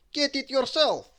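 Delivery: loud, high cheeky retort; defiant storybook comeback; Mister Cheeky. A narrator's reading. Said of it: "said Mister Cheeky".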